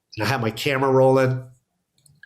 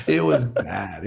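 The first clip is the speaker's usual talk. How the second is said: laughing; much slower than usual. Real, not acted. Laughing about how badly he bombed.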